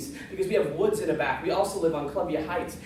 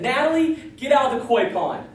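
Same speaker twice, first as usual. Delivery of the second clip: retold yell; 5 semitones higher, 5 dB louder than usual. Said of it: "she yelled at my sister".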